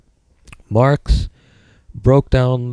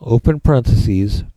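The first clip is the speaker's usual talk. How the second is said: dictating; slower than usual. Shown as a demonstration. Dictating notes to the class.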